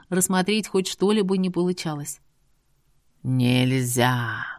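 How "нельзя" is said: low, slow, stretched-out warning; drawn-out eerie voice. A narrator's reading. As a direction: drag the word out like a ghost. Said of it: "Растянуто прозвучало".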